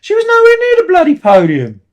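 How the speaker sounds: rising into an outraged yell; exasperated rant. Real, not acted. Fan ranting at the show, building to a high angry shout. Ranting about plot hole.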